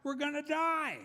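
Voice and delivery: high, panicked wail; screaming panic. Acted. Castaway in the pastor's joke yelling panic at his friend. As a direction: shriek it like a doomed castaway. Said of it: "One started screaming and yelling".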